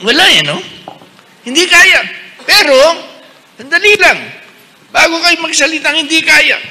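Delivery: high, loud preaching push; forceful preaching. Real, not acted. Bishop rousing the congregation, high and loud. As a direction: drive the point home to the crowd.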